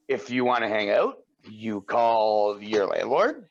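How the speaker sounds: slow, stretched-out canned reply; drawn-out mock instructions. Answering a friend's invite through his landlord, drawn-out mock instructions. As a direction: draw out each step as if dictating procedure.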